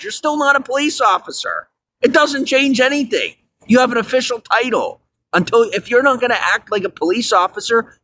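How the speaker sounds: high, furious tirade at the cops; heated outburst, voice climbing high; angry rant. Real, not acted. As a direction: tear into them, pitch way up. Ranting at cops who snitch.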